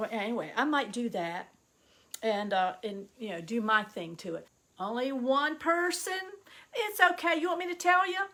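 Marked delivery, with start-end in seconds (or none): none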